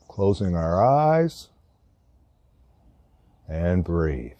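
slow, low breathing-guide voice; guided meditation. Acted. Meditator guiding listeners through cleansing breaths, slow guided meditation. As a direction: pace each cue with the breath, letting "out" go on the exhale.

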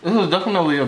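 peeling mask off chin; through a face mask. Real, not acted. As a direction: stiff mask around lips. Seen 1: grey peel-off mask covers face; hands pull it at chin throughout.

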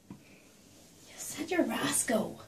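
very soft, affectionate tease; whispered aside. Real, not acted. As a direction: murmur it almost under your breath. Murmured asides about the toddler.

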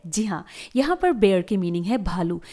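ordinary delivery

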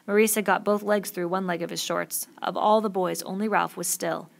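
ordinary delivery, nothing marked

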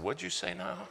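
high, laughing disbelief; can't believe what he just heard; stunned disbelief. Retold or imitated. Preacher hears the lady is a man, high with a laugh. Told the woman was a man.

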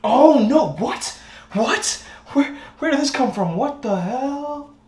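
high startled disbelief; shocked. Real, not acted. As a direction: gasp out the surprise. Paint appears unexpectedly.

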